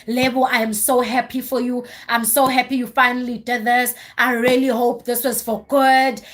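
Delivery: normal talking voice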